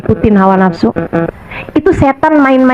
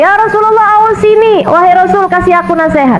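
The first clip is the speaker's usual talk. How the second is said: put-on same young man asking again, pitched much higher and pleading; insistent repeated request; 7 semitones higher than usual. Young man asks Prophet again, higher pleading request. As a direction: push the plea up, more insistent. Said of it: "dia nanya lagi".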